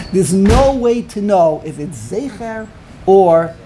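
slow, each word placed; slow, measured reading of the ruling; slow deliberate quote. Retold or imitated. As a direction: lay it out slowly and plainly. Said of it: "That's what he says".

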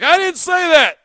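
sudden high, loud protest; angry outburst. Retold or imitated. Host snapping at the guest on air, angry shout. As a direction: cut in hot, defensive and sharp. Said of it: "He got hot to the point where he cut me off and was like".

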